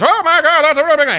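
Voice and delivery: high, loud Southern preacher. Shown as a demonstration. Host doing a Southern preacher voice about election, Southern preacher. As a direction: preach it big in a Southern accent. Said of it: "I'll do my Southern preacher voice".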